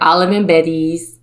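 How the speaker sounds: normal talking voice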